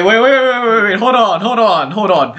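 high, loud, rapid wait wait hold on; alarmed realization. Real, not acted. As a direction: stop everything in a sudden panic. Sudden realization, stopping himself.

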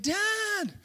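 lightly voiced whiny child; a touch of a nagging boy calling his dad; annoying kid voice. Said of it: "Charlie's like".

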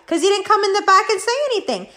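normal talking voice

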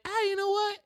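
squeaky put-on reconsidering voice; mock witch voice. Befana telling the wise men she'll come after all, in a high mock voice. Witch changes her mind.

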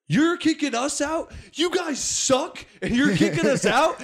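mock the drunk kid's indignant protest. Comic retelling the drunk friend's whiny protest, high and outraged. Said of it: "And he's like".

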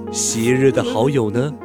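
ordinary talk

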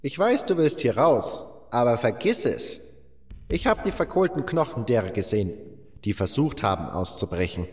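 a grim warning from someone who has seen it; old miner warning. Acted. Old butler warns protagonist.

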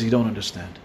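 low, soft retold reply; hushed explanation. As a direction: drop low and quiet, as if confiding. Man begins to explain himself.

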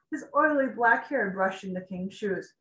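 usual speaking voice

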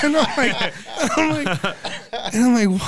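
cracking up mid-story, voice pitched high; helpless laughing, struggling to carry on; real laughter. Real, not acted. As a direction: laugh through the words.

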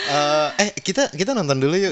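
high-pitched, perky invite; playful, upbeat asking; eager invitation. Acted. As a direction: pitch it up, keen to go. Acts out eager friend's invitation.

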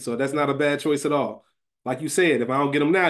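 usual speaking voice

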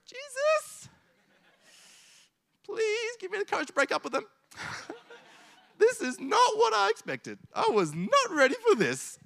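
very high, squeaky, desperate plea; panicked pleading prayer. Retold or imitated. Unhappy partner begging Jesus for courage, shrill and frantic. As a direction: squeal it like someone trapped in a bad relationship.

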